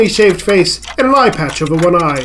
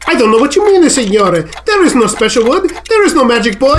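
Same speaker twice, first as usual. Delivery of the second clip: put-on higher, pleading Italian accent; defensive Italian woodcarver; 5 semitones higher than usual. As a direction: deny it all as a nervous Italian craftsman. Woodcarver denies everything.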